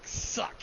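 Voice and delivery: sharp unvoiced suck noise; voiceless throat suck like the parrot; parrot suck imitation. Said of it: "I'm just like".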